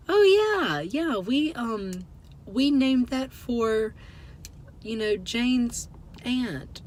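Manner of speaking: imitated high-pitched polite cover-up, slowing down; trying to be nice. Imitating the hybridizers smoothing over the blunder, trying to be nice. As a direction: mimic someone kindly fumbling for an excuse. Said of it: "they tried to be nice".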